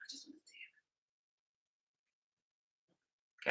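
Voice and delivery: sneaky whisper; pleading whisper. Retold or imitated. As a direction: whisper it like a guilty little plea. Friends threaten to take phone.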